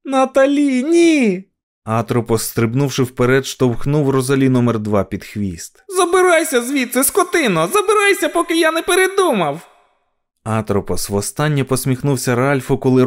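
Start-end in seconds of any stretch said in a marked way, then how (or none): 0.0-1.4 high, loud cry of alarm; desperate high shout; shouted warning. A narrator's reading. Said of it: "крикнула Луїза". 5.9-9.7 very high-pitched nasty villain; high, threatening little creature; spiteful dwarf. A narrator's reading. Villain dwarf drives dog away.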